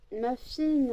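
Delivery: first, lighter call of the dog's name. Real, not acted. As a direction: call her over, lifted. Calling the dog.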